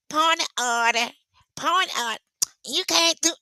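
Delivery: high-pitched mimicry; imitation of a high-voiced woman at a meeting; Candace. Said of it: "Candace's voice".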